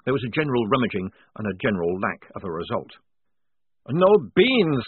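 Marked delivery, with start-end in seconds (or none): 3.9-4.8 louder, higher anguished moan. A narrator's reading. Maledict mourning his lost coffee beans, moaning. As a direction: wail it in despair. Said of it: "moaned Maledict".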